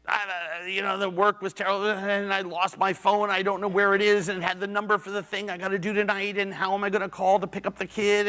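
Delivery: high, fast, breathless frantic rant; imitating his wife in a panic, high and rushed; frantic retold wife. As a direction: rattle it off like everything just fell apart. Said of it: "she's just on fire".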